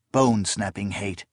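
ordinary delivery, nothing marked

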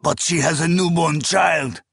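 crying out. A narrator's reading. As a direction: call out in appalled protest. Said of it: "another cried".

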